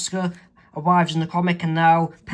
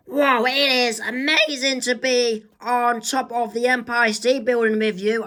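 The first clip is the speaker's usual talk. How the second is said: put-on higher, awed girl; Mabel amazed; 6 semitones higher than usual. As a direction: gush about the view.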